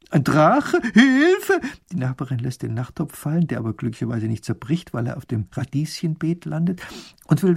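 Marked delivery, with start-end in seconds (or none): 0.1-1.6 jumping to a very high, loud scream of Hilfe; startled fright rising to a shriek; panicked cry for help. A narrator's reading. Neighbour panics on hearing of the dragon, crying for help.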